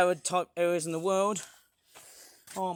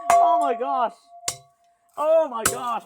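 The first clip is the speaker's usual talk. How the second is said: amazed exclaim; 5 semitones higher, 7 dB louder than usual. Real, not acted. Laughing aftermath.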